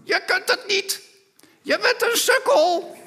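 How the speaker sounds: very high, loud parrot screech; squawking parrot. Pretend. Parrot mocks the repairman from a distance, shrill squawk. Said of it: "hoort op een afstand iemand roepen".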